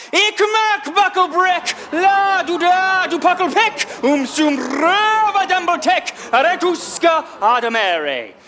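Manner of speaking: high, slow, drawn-out puppet shout. Acted. Puppet on a bucket stunning Frank Hill, shouted puppet voice. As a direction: shout the nonsense out to stun them. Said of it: "shout out the whole thing".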